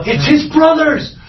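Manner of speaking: high, slow excited cry of eavesdropping servants. Acted. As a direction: blurt out the news, thrilled and high.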